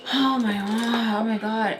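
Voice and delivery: slow, exasperated oh-my-God; retold agitation, drawn out; mildly fed-up groaning complaint; agitated. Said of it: "I'm getting agitated 'cause I'm like".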